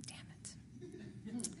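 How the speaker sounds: breathy whispered damn it; whispered self-reproach. Real, not acted. After misnaming Rick, whispering to herself. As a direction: curse under your breath at the slip. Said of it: "my bad".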